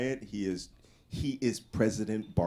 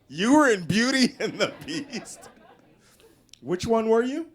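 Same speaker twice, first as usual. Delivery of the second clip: high, loud laughing disbelief; incredulous; 11 semitones higher, 5 dB louder than usual. Retold or imitated. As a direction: ask it, amazed and laughing. Friends baffled at Ron.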